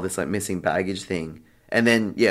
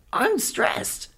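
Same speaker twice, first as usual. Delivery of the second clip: very high, strained retold squeal; stressed out. Traveller answering the concierge, mock stressed-out squeal. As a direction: act out being at breaking point, pitch shooting up. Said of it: "freaking out".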